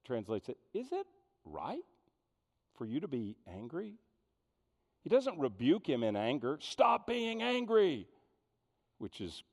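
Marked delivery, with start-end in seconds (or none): 0.7-4.0 high, soft and gentle; gentle question. Acted. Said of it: "The N I V says". 6.6-8.1 higher, slow stern parent snapping; scolding parent. Retold or imitated. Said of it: "Any parent ever said that?".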